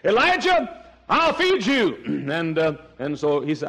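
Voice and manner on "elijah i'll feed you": full-force preaching. Acted. Preacher voicing God to Elijah, high impassioned delivery.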